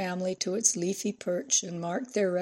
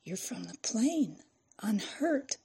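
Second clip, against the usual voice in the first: hushed, softer astonishment; whispered; 3 semitones higher, 5 dB quieter than usual. A narrator's reading. Reporter realising the girl survived the crash, whispered. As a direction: drop to a hush in wonder. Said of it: "as she whispered".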